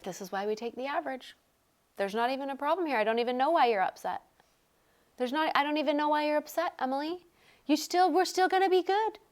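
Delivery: put-on a light British accent, quick and breezy. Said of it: "in a British accent".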